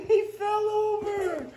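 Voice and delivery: laughing through the words; chuckling it out. Real, not acted. Laughs as child falls.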